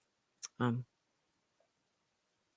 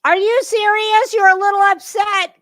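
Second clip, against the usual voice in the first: much higher and louder, mocking disbelief; incredulous mock outrage; 10 semitones higher, 8 dB louder than usual. Real, not acted. Commentator mocks the streamer saying she's upset. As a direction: throw her words back at her, scandalised.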